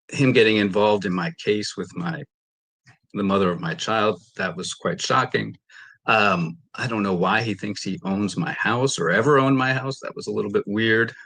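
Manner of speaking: ordinary talk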